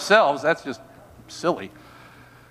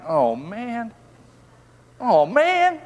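mock groan; 7 semitones higher, much slower than usual. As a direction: groan in pretend dismay. Mock exasperation at Paul's verse.

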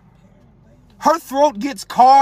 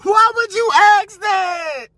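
mock crying; 11 semitones higher, slower than usual. Imitating the crying boyfriend.

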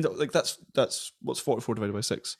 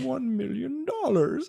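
much higher and slow, drawn-out villain demand; Doctor Evil impression; 11 semitones higher, slower than usual. Quoting the film villain to friends, a high slow hammy demand. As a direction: savour each word like a smug movie villain. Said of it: "He goes like, he's like".

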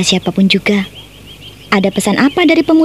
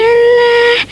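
teasing scoff; 12 semitones higher than usual. Acted. Friend teases her about meetings.

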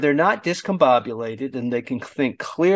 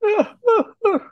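mock sob; 4 dB louder than usual. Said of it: "I'm crying because".

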